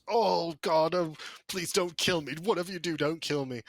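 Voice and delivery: high, lightly whimpering plea for his life. Acted. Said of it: "the guy whimpers".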